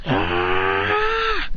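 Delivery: low, drawn-out growling roar; imitated anime power-up cry; power-up yell. Character powering up.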